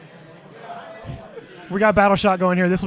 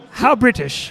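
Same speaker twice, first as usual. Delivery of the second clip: mock British; slower than usual. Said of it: "let me ask you a question".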